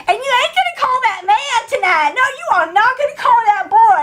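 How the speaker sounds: high, loud, bossy lady; bossy woman. Retold or imitated. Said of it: "one of the women's like".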